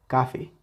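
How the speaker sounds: "café" stressed on "ca", weak "fe"; sin fuerza en la última. Shown as a demonstration. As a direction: say "café" as CAfe. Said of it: "Si le quitamos esa intensidad". ("café" con el golpe en "ca", "fe" débil)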